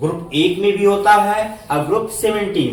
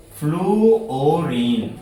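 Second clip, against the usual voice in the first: slow, drawn-out "फ्लूओरीन"; sounding out; much slower than usual. Shown as a demonstration. Teacher sounds out an element name for pupils, slowly. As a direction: stretch "फ्लूओरीन" syllable by syllable.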